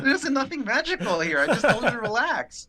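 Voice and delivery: high, loud, laughing insistence; laughing protest. Retold or imitated. Agent answering amazed coworkers, laughing protest. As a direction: insist through laughter, pitch way up.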